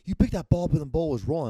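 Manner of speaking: loud, high-pitched accusing golfer; angry accusation. Retold or imitated. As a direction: imitate an irate golfer's accusation. Retold golfer accusing the teller.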